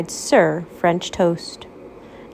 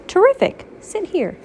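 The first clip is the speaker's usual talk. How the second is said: eager professor; 10 semitones higher than usual. Acted. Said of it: "she said".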